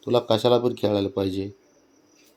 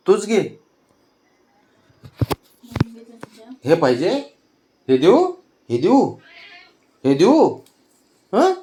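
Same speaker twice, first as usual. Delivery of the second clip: much higher, louder sing-song questions; 10 semitones higher, 6 dB louder than usual. Real, not acted. Grandmother offering toddler a plant to play, high sing-song.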